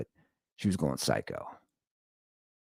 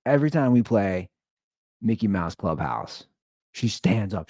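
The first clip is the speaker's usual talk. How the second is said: usual speaking voice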